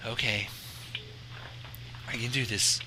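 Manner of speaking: quietly brave. Acted. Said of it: "kept her courage strong".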